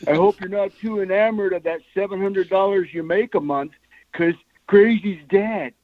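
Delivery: bad Larry Hama. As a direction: do a deliberately bad impression, calling out the first words. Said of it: "I'll do my bad Larry Hama impersonation".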